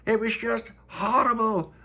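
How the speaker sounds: high, slow, dwelling on "horrible"; slow, high, aghast; slow dismayed repeat. Acted. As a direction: drag out "just horrible" in dismay. Tony repeats, slower.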